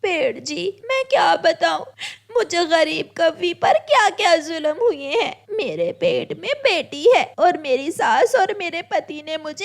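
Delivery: high-pitched, weepy crow wife; tearful crow. Acted. Homeless crow pours out her troubles to the tree, tearfully. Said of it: "रोने लग जाती है".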